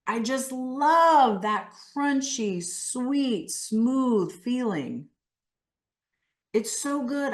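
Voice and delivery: high, drawn-out relish; animated. Shown as a demonstration. Coach modelling delight in food for the group, animated. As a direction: linger emphatically on each food word. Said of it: "why I'm saying it so animated".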